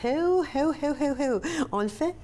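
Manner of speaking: high, mock-British "heu heu" laugh; British-accented laugh. Shown as a demonstration. Host showing how to laugh like the Queen, British-accented. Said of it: "avec l'accent britannique".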